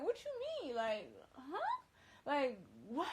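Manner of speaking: incredulous high-pitched questioning, rising at the end; shocked disbelief. Retold or imitated. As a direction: retell it as a stunned, disbelieving comeback. Retelling her shocked reaction to him.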